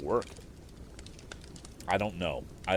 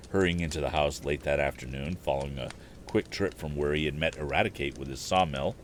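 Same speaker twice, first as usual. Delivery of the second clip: plain reading voice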